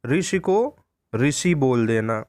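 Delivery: "ऋषि" said slowly the wrong way, vowel sound altered; mispronunciation demo. Shown as a demonstration. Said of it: "एक शब्द है ऋषि". (गलत उच्चारण का धीमा प्रदर्शन)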